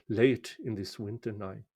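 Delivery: usual speaking voice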